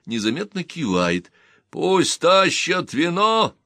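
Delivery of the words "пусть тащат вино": loud, slow order, pitched higher; commanding. Acted. Гэт orders wine for the table, loud and commanding. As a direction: boom it out like a host calling for wine. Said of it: "командует Гэт".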